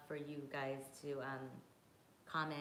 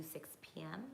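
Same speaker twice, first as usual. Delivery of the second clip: whispering; 10 dB quieter than usual. Real, not acted. Aside while planning the watch party.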